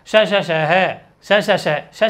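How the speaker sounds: all three sibilants read as the same "श"; mistaken recitation. Shown as a demonstration. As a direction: drag out "श, श, श" identically. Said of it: "क्या में कैसे पढ़ते हैं".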